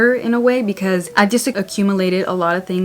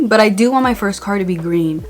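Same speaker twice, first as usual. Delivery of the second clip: usual speaking voice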